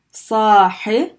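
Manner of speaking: ordinary delivery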